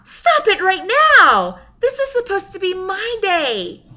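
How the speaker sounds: loud, high exasperated shout; yelling. Acted. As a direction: yell it out in frustration. Bride snaps at her mother.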